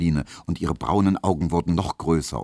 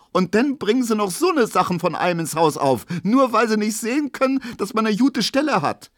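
high, agitated ranting; indignant tirade; 14 semitones higher, 4 dB louder than usual. A narrator's reading. Pauline complains about the ungrateful porter's family, outraged. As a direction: pour out grievances in a raised pitch.

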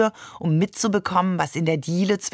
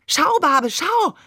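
high, eager call for attention; excited little kid calling out; proud child; 10 semitones higher, 3 dB louder, slower than usual. A narrator's reading. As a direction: show it off to a parent, excitedly. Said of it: "Der Seestern präsentierte stolz".

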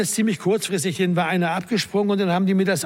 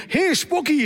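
Zuruf; 9 semitones higher, slower than usual. A narrator's reading. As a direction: call out high to get his attention. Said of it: "rief er". (hoher, verhaltener Zuruf)

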